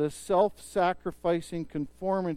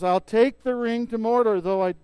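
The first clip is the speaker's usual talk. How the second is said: bold offer; 6 semitones higher, 8 dB louder than usual. Retold or imitated. Frodo volunteering over the crowd.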